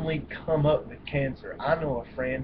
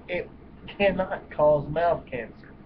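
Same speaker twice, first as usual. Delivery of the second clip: normal talking voice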